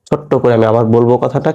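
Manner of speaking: usual speaking voice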